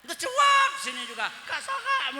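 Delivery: high, laughing call; giggly high-pitched call. Retold or imitated. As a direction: laugh through the words as you call out. Laughing while voicing wife.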